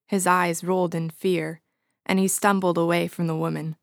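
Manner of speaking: usual speaking voice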